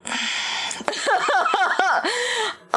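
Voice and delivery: high burst of laughter; laughing. Real, not acted. Laughs at old clip.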